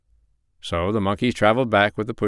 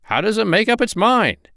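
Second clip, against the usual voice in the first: very high-pitched, incredulous question; astonished duck cry; 12 semitones higher, 3 dB louder than usual. A narrator's reading. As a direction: exclaim it high, as a baffled duck. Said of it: "cried the duck".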